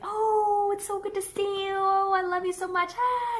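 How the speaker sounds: put-on high, gushing baby-talk greeting to a dog; excited play voice. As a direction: gush over the dog like you missed it all day. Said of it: "if I were to engage in play and be like".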